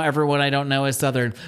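usual speaking voice

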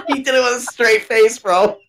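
high-pitched, laughing through the words; cracking up. Real, not acted. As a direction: laugh it out, barely holding it together. Cracking up over own prank story.

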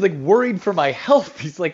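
usual speaking voice

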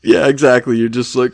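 usual speaking voice

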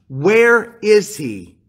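drawn-out, weighty where is he; slow king's question. Retold or imitated. King asking the servant Ziba, slowly and weightily. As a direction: let each word hang, demanding. King asks after Jonathan's son.

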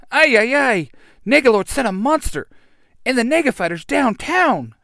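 high, loud, agitated cry; panicked alarm. Acted. Alpha warning the Rangers of a monster downtown, high panicked alarm. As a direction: sound the alarm in a fluster. Robot helper raises the alarm.

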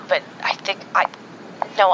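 ordinary talk

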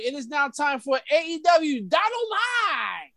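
big, sweeping segment announcement; show announcer. Pretend. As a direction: stretch out the show's name like a ring announcer.

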